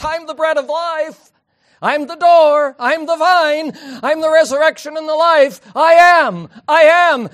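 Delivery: high, loud ringing declaration of each saying; loud proclamation. Acted. Preacher voicing Jesus' sayings to congregation, loud and high.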